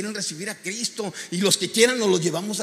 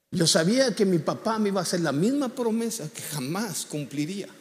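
usual speaking voice

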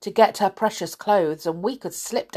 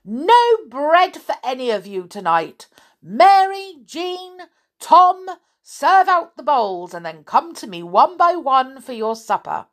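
put-on high, loud scolding old woman; bossy old woman; 6 semitones higher, 5 dB louder than usual. Old woman ordering Rick off, high and loud. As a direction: bark orders like a fierce old lady.

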